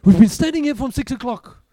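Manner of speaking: loud, high griping about the wait; the fed-up man in the queue griping loudly about the wait; mock outraged complaint. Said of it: "it's like".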